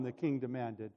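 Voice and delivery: lively, expressive Bible narration; storyteller at the lectern; scripture story reading. Acted. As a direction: read the story aloud with animated quotes. Reads the Bible story aloud.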